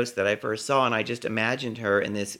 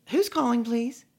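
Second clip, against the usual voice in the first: high, polite phone screening; young woman answering; 12 semitones higher than usual. Retold or imitated. Young woman screens Peggy's late caller, high and polite. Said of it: "A young sounding woman answered".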